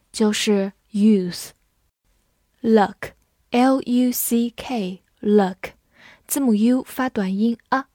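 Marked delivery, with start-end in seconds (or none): 2.6-5.7 slow, letter by letter; teacher spelling a word slowly. Shown as a demonstration. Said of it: "spells out the word".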